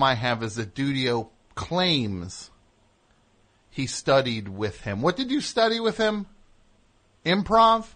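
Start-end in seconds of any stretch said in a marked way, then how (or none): 5.0-7.9 high mocking disbelief; incredulous high pitch. Real, not acted. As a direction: skeptical high questioning. Mocking skeptical questions.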